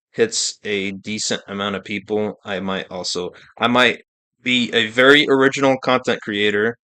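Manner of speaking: normal talking voice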